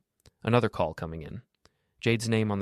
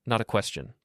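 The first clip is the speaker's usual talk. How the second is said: ordinary delivery, nothing marked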